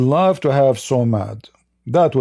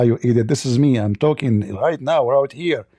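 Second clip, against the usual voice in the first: usual speaking voice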